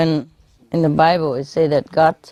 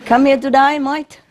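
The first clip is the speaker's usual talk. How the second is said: a teasing, lightly put-on Australian accent, higher; mock Australian accent; 7 semitones higher than usual. Comic teasing the New Zealander in a mock Australian accent. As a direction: tease it in a fake Aussie twang. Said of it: "Why you talk like Australian?".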